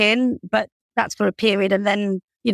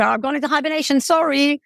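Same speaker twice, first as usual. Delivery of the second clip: higher-pitched sheepish excuse; playfully sorry, pitched up; mock apology; 7 semitones higher than usual. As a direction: beg off in a high, apologetic voice. Acted excuse for not going out.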